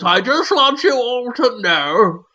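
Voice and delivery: put-on slow, high old-man voice; high, drawn-out old gent impression; imitated old man. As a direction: drag it out as a doddery sitcom elder. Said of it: "The old man climbs out of it and goes".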